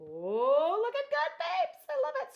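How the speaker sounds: high, loud giddy gushing. Real, not acted. Crafter admiring the work to viewers, giddy. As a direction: whoop with real giddy delight. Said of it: "Sorry, I just got all giddy".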